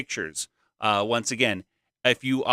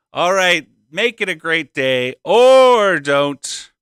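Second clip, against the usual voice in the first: loud, drawn-out announcer catchphrase; booming sign-off; 5 semitones higher, 11 dB louder, much slower than usual. Real, not acted. Host signing off to the school, booming and drawn out. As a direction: belt it out, stretching the words.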